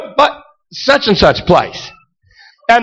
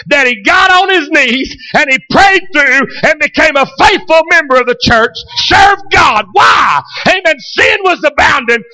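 full-force preaching; 6 semitones higher than usual. Acted. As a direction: preach it out, building to a shout.

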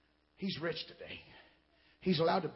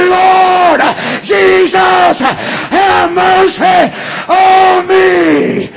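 high, loud, drawn-out preacher's cry; full-throated cry for mercy; 8 semitones higher, 11 dB louder, much slower than usual. Acted. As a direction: stretch every word into a pleading shout. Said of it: "I'm gonna cry and say".

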